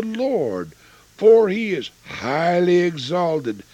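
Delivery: 3 proclaim the song of Moses to the rafters; 4 a preacher declaiming a Bible passage; 1 scripture reading. Acted. Reads Exodus song aloud.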